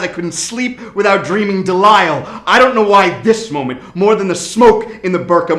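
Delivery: panicked confession, building to a cry; panting shaky eyewitness. Acted. Frantic climax of the poem.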